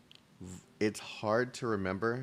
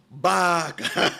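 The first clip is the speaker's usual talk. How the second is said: mock Baka; 8 semitones higher, 7 dB louder than usual. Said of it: "Baka's gonna come in this bitch and be like".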